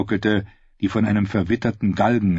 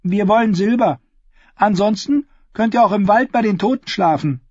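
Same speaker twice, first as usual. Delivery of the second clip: put-on higher girl's voice, businesslike; a girl on the porch sizing up strangers, higher and guarded; wary ten-year-old Willow; 12 semitones higher, 4 dB louder than usual. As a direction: sound like a watchful child running the inn. Willow insists.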